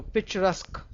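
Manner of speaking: "picturesque" closing on a crisp K; correct pronunciation. Shown as a demonstration. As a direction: say "picturesque" as "pic-chuh-resk". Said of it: "Q U E is always pronounced as".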